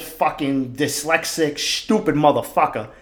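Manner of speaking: normal talking voice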